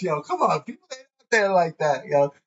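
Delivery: ordinary delivery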